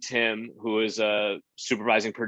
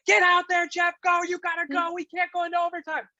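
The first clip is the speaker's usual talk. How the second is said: much higher, pushy earpiece yelling; directors yelling. Retold or imitated. Directors in the earpiece urging the host on. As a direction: hurry him out, frantic. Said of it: "They're yelling at me".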